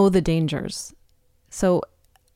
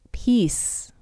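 a breathy hush on peace; whispered; 5 semitones higher than usual. Real, not acted. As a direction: drop to a whisper on the word peace. Whispered key word in reflection.

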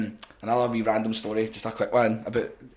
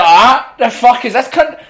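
high, loud, outraged retelling; retold angry outburst; 11 semitones higher, 14 dB louder, slower than usual. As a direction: act out indignant disbelief at full volume. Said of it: "I'm really angry right now".

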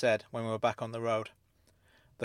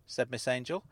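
ordinary delivery

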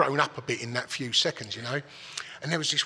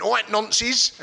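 loud, high mock call of all right, nonces; loud greeting; 7 semitones higher, 6 dB louder, much slower than usual. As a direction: bellow it across the room like a cocky lad. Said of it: "a fucking proper loud".

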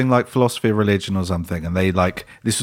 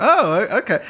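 acted surprise; 10 semitones higher than usual. Retells taking in the surprise.

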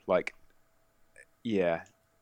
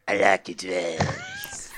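character voice; much slower than usual. Retold or imitated. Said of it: "I think we just had a".

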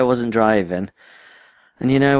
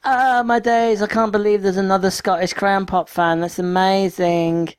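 gushing fan voice; 11 semitones higher than usual. Acted. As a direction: mimic an amazed fan in a high voice. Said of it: "Although probably not in that voice".

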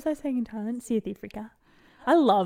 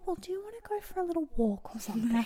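light, higher-pitched, softer mimicry; imitated partner's suggestion; 8 semitones higher, 6 dB quieter than usual. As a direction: gently coax in a mock high voice. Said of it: "And then I just hear".

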